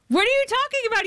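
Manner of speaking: stunned disbelief. Retold or imitated. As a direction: blurt it out, totally floored. Stunned reply to her agents.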